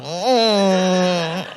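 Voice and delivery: usual speaking voice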